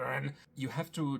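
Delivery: ordinary talk, nothing marked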